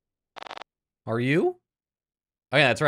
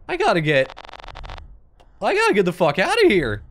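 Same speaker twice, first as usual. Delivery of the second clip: higher, louder urgent outburst; desperate to escape; real alarm while retreating; urgent shout; 6 semitones higher, 4 dB louder than usual. Real, not acted. Fleeing in the game.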